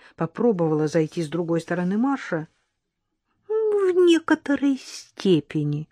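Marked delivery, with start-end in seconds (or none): 3.5-5.9 higher, slow, secretive lilt; mysterious. A narrator's reading. Maid teases the nanny with a mysterious hint. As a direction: dangle a hint she won't explain. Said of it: "загадочно ответствовала горничная".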